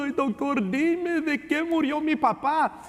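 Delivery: high, pleading mock sob; a daughter begging the doctor, tearful; crying grandmother. Grandmother crying to doctor, high pleading voice. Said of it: "ay, llorando".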